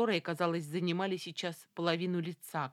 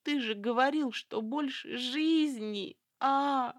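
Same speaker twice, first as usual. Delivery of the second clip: high, desperate pleading cry; frantic shouting hostage taker; 7 semitones higher, 3 dB louder, slower than usual. A narrator's reading. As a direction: keep begging, near tears. Hostage keeps pleading to lover. (надрывная мольба)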